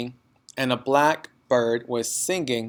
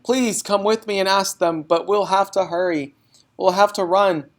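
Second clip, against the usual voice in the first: high, loud pleading rush; girl urging teacher to hurry; urgent child; 7 semitones higher, 4 dB louder than usual. Acted. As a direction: pull her along.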